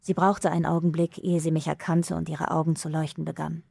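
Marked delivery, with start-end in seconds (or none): none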